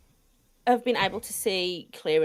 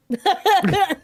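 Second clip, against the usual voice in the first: laughing through the words; amused, giggling answer; 9 semitones higher, 8 dB louder, slower than usual. Real, not acted. Laughing at own question.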